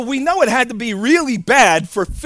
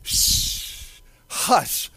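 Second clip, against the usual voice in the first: long breathed shh, then a soft hush; 4 semitones lower, 9 dB quieter than usual. Acted. Jesus calming the sea, soft hushing. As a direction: softly shush the waves. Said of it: "he said to the sea".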